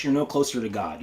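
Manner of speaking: normal talking voice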